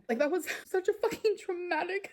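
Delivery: high, strained, upset voice; real distress pushing the voice up; near tears. Real, not acted. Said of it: "so fucking sad right now".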